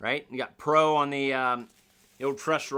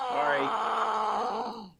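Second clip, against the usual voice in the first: shouted sorry; 7 semitones higher than usual. Real, not acted. Apologising to a buddy across the room, a shouted call.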